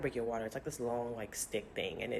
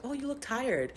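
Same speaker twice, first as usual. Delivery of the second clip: chirpy resident; 11 semitones higher than usual. Retold or imitated. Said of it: "the third one was like".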